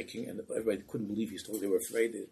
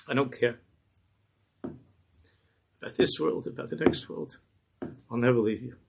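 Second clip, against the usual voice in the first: earnest plea; 2 semitones higher, 3 dB louder, slower than usual. Acted. Jester's devoted plea to rebbe.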